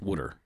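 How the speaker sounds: WATER said as "wooder"; WATER with the vowel of "wood"; wooder pronunciation. Shown as a demonstration. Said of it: "W O O D E R".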